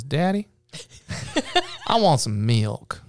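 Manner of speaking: lightly voiced Southern toddler asking for milk; little Southern boy. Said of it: "he says".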